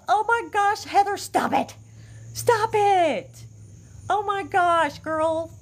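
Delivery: high, overwhelmed pleading; touched, near tears. Real, not acted. Host begging a generous viewer to stop, high and emotional. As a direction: protest in a high voice, close to crying. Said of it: "You're gonna make me cry".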